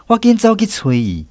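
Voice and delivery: ordinary delivery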